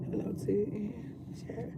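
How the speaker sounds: soft breathy whisper to chat. Real, not acted.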